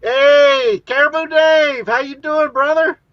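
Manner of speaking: hearty shout of welcome; loud greeting. Real, not acted. Greeting a friend on air.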